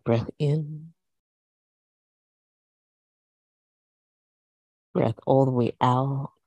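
calm, steady class cues; yoga instructor voice. Acted. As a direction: guide the flow evenly.

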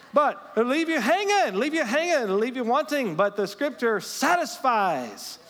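high, loud, fired-up preacher; full-force preaching. Real, not acted. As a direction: preach it at full voice, driving upward.

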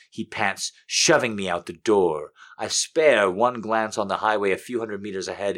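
plain reading voice

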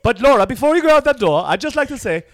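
mock posh English parent, loud and high; a parent in a put-on English accent warning his daughter. Parent mimicked in a loud English accent before she goes out clubbing. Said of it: "why they've got an English accent".

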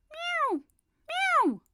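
very high loud meows; plaintive cat wail; cat crying meow. A narrator's reading. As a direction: cry out like a frustrated cat. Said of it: "cried Simpkin".

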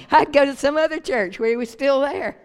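joking with a laugh in the voice. Real, not acted. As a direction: say it through a laugh. Joking with congregation, laughs around it.

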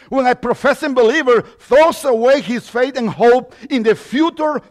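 loud, rhythmic sermon cadence; fervent preaching. Acted. Pastor warning believers about loving the world, fervent preaching. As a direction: pound out each "radically different" line.

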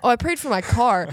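ordinary delivery

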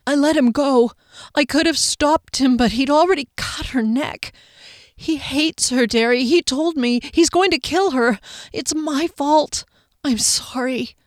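high, tearful apology, slowing at the end; tearful confession. A narrator's reading. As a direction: confess through tears, broken and sorry. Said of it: "Tears streamed down the pale cheeks".

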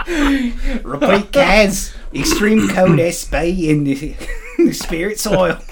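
high-pitched, badly faked Australian accent breaking into laughter; bad Aussie accent. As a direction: crack up while botching an Australian accent. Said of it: "put on my bad Aussie accent".

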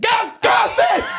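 very high-pitched mock shout; retold yell. Interrupted in bed by dogs, mock panicked yell.

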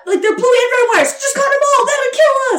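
high, bright-eyed girl playing bomb squad; high-pitched mock bomb-defuser chatter; excited Maya. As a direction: pitch way up and play the eager kid. Acting out Maya's bomb dialogue.

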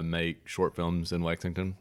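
ordinary delivery

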